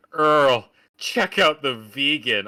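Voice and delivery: loud, high mock diner holler; hollering waitress. Waitress mocking a vegan customer to Earl, hollering. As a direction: call across the diner, drawing out the words.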